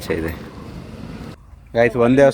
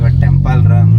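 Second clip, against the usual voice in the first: loud, lower-pitched title call; loud announcement; 4 semitones lower, 8 dB louder, slower than usual. Real, not acted. Vlogger names the day's temple trip for viewers, loud announcement. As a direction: call it out big and low.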